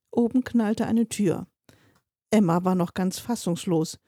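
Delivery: usual speaking voice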